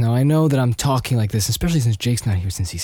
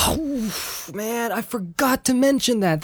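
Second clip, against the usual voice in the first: much higher sudden "oh, man"; sudden realization; 11 semitones higher, slower than usual. Real, not acted. Realizing he forgot Godzilla cinematography, surprised. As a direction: catch yourself remembering something. Suddenly remembering a missed point.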